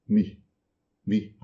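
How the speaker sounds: "Mi" with a short I. Shown as a demonstration. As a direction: clip "Mi" short. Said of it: "Um I curto no final". ("Mi" com I curto)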